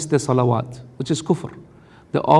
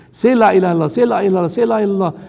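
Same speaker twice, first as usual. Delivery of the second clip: urgent pleading; 5 semitones higher, 3 dB louder, much faster than usual. Retold or imitated. Prophet urging dying man.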